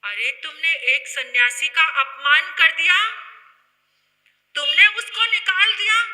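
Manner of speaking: loud, high, climbing accusation; indignant reproach. Acted. Jogan scolding villagers over the insulted monk, loud and outraged. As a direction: rebuke them loudly with rising outrage.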